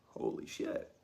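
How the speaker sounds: breathless unvoiced holy shit; awed whisper. Narrator relives shock in the car, whispering. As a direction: whisper it in stunned awe. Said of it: "was like".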